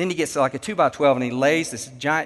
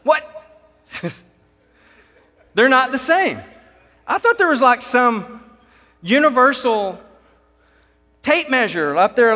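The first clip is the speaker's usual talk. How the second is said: higher and louder, hammed-up astonishment; indignant, pitched-up mock surprise; mock disbelief; 8 semitones higher, 6 dB louder than usual. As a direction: play the baffled rookie. Speaker mocks his own naivety.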